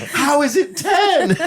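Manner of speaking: high, slow, laughing incredulity. Retold or imitated. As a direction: stretch it out through laughter. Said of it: "Justin almost lost his mind".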